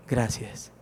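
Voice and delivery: hushed thanks. Pretend. Grateful believer thanks God, soft and low. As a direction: breathe out a quiet thank you.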